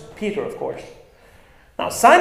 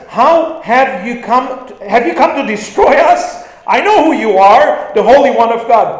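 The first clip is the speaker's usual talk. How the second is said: a hammed-up demon, higher and louder, crying out; 6 semitones higher, 8 dB louder than usual. Demon shouting at Jesus in the synagogue, hammed-up cry. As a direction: voice the demon's frightened challenge to Jesus. Demon voiced in Gospel retelling.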